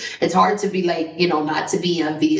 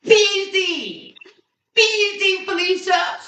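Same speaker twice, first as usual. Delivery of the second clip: hyped celebration; 13 semitones higher than usual. Retold or imitated. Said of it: "And I'm like".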